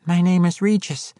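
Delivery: high, small character voice; quiet halfling. A narrator's reading. Halfling introduces himself to the swordswoman, quietly, pitched high. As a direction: say it small and high, without force. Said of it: "the halfling said quietly".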